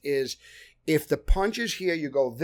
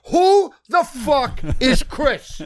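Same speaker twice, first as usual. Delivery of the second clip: louder, higher, slow menacing yell; in-character fury; 10 semitones higher, 8 dB louder, much slower than usual. Retold or imitated. Actor snapping at the director in character, furious yell. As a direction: stay in character and threaten him. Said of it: "I go".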